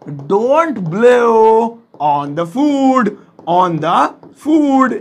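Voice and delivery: "Don't blow on the food" very slowly, stretching "blow" and "food"; teacher's slow model of "Don't blow on the food"; word by word. Shown as a demonstration. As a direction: draw out each word, then repeat "on the food" in pieces. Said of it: "so simple है".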